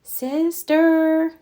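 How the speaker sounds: usual speaking voice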